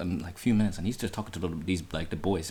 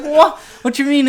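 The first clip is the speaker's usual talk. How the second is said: high, loud, disbelieving questions; retold alarm; 8 dB louder than usual. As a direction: burst out in startled confusion. Retold alarmed reply to news.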